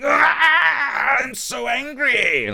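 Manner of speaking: high, drawn-out hammed-up angry scream; mock rage. Imitating Facebook users whipped up to a frenzy, mock-enraged scream. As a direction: wail it in exaggerated fury. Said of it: "stir people's rage up going".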